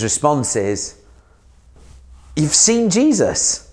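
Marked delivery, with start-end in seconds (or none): 2.4-3.7 higher, slow incredulous scoff; mock their claim, drawing it out; scoffing disbelief. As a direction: Thomas scoffs at the others. Thomas scoffing at the disciples.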